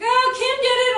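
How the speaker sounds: mock tattle, high and loud; tattling kid. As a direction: tattle on a classmate, pitched right up.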